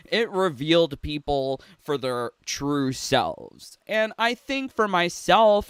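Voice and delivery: ordinary talk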